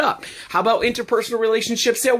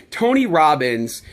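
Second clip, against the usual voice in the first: ordinary talk, nothing marked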